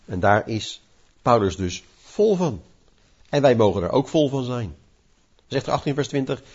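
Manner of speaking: ordinary talk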